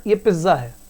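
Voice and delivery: पिज्जा as "piz-za", buzzing z and no t; common mispronunciation. Shown as a demonstration. Teacher mimicking what pupils would say, mispronounced. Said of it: "आप ऐसे बोलोगे".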